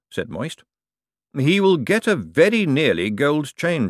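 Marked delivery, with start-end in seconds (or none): 1.4-3.9 slower, drier lightly voiced lord. A narrator's reading. Vetinari echoes Moist drily.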